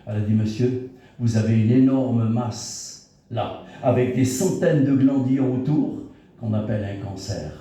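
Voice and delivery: normal talking voice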